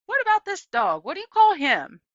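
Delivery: put-on high, loud old lady question; lively old woman. A narrator's reading. Gloria asking Opal the dog's name, loud and high. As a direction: ask it with bright curiosity.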